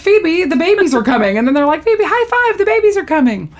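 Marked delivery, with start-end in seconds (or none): 0.0-1.4 high-pitched mock urgency; excited urging. Friends telling Phoebe about the babies, urgent. 1.8-3.5 very high, hammed-up cheer; excited cheer. Friends high-five Phoebe over babies coming, excited.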